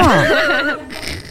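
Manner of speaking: high-pitched burst of laughter. Real, not acted. Laughing during charades.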